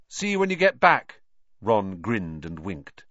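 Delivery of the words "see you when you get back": loud, high parting call; raised, lifted farewell. A narrator's reading. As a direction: call after a departing friend. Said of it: "Harry called to Ron".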